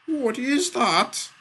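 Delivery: very high, loud, drawn-out wail; panicked wail. A narrator's reading. Arsonist hears a voice from empty air, wailing. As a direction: wail each word slowly in alarm. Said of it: "wailed the arsonist".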